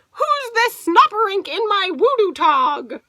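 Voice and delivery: put-on high, loud upset baby alien; baby Bleem finding a stranger in bed, high and loud; baby alien crying out. A narrator's reading. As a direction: cry out high like an indignant little alien. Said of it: "cried the baby Bleem".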